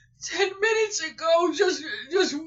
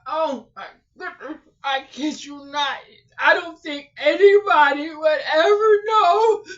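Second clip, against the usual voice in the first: ordinary talk, nothing marked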